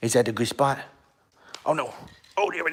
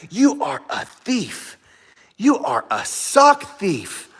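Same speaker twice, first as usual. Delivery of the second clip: mock accusation; 4 semitones higher, slower than usual. Mock scolding dog thief.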